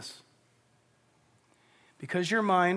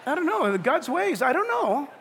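high, loud, laughing, throwing up his hands; mock exasperation, laughing through it; exasperated comic reply; 9 semitones higher, 5 dB louder than usual. Pastor answering the patient's question, laughing exasperation.

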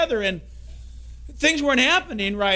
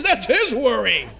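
laughing punchline; 4 dB louder, slower than usual. Retold or imitated.

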